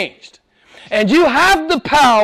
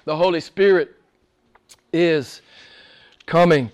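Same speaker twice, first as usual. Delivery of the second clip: ordinary talk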